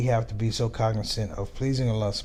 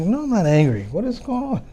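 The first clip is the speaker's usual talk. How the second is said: exasperated dad; 10 semitones higher, 6 dB louder than usual. Retold or imitated. Dad reassures, then baffled.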